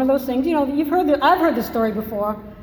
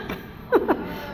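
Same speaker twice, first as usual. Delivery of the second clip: breathless laugh; 9 semitones higher, 4 dB quieter than usual. Real, not acted. Laughing at her own story.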